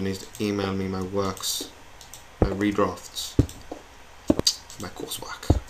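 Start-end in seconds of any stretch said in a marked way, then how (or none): 4.8-5.6 whisper. Real, not acted. Aside whispered.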